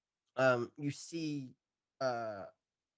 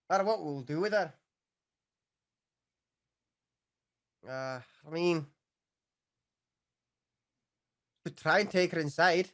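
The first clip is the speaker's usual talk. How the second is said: gruff guard voice; 4 semitones higher, 6 dB louder than usual. Acted. NPC guard dialogue voiced.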